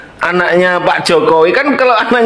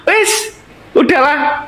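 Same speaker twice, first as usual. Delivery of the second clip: laughing through pain; 7 semitones higher than usual. Real, not acted. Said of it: "Aku ini sampai ketawa, perutku sakit".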